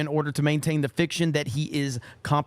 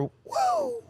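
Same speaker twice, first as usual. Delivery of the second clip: strained, much higher hoarse Ric Flair woo. Retold or imitated. Streamer attempts a Ric Flair woo, hoarse and cracking. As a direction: squeeze out a cracking wrestler's woo. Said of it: "I can't even do a Ric Flair".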